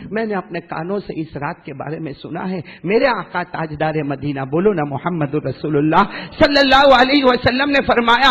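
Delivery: ordinary delivery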